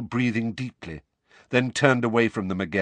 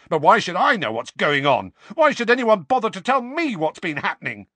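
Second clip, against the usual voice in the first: loud, high, indignant questions; angry demand; 10 semitones higher, 6 dB louder than usual. A narrator's reading. Harry demanding to be told, angry. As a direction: demand answers, stung and furious. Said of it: "his fury at being followed".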